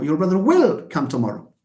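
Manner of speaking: firm contrastive weight on "will"; stress on WILL. Shown as a demonstration. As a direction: insist by hitting "will".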